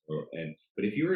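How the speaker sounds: usual speaking voice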